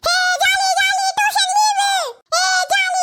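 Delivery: high, drawn-out sing-song cheer; gleeful child cheering. Acted. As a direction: whoop like a kid let off class. Child cheers tuition holiday.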